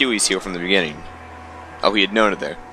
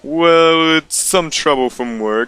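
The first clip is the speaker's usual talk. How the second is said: slow, lightly voiced husband; character voice; 3 semitones higher, 3 dB louder, slower than usual. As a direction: brush it off slowly. Reader voicing character dialogue.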